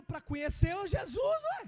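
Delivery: put-on light child voice, proud and insistent; son's reply. Father voicing son's answer.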